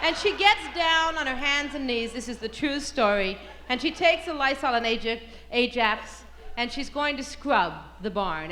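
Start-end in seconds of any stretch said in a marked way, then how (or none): none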